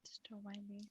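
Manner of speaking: barely audible, voiceless whisper; hushed self-effacing whisper; whispered aside. Real, not acted. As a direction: slip it in under your breath, very softly. Self-effacing aside after interrupting the planning.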